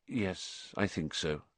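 quiet, restrained assent; grim. A narrator's reading. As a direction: agree softly and gravely. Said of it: "said Lupin grimly".

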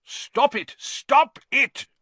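high, loud frantic cry. A narrator's reading. Manager separating fighting books, crying out. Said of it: "cried the manager".

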